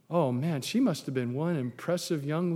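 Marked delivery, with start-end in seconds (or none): none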